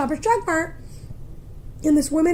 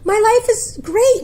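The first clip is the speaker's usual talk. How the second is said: high, loud mock consoling; acted frantic comforting; over-bright reassurance; 9 semitones higher, 5 dB louder than usual. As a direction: reassure a crying stranger, pitched way up. Reassuring the sobbing stranger.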